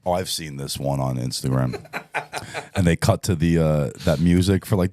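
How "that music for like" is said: ordinary talk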